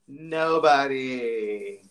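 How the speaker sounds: slow deadpan. Real, not acted. Deadpan punchline, no sponsors.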